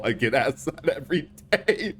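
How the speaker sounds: amused, laugh bubbling through the words. Real, not acted. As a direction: say it while starting to crack up. Laughs at silly chat question.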